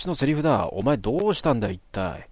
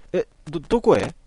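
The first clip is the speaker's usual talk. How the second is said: higher, stammering question; flustered question; 6 semitones higher than usual. Acted. Natsumi flustered as Alisa heads out, higher voice. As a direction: ask it caught off guard.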